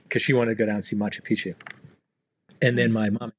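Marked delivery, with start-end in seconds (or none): none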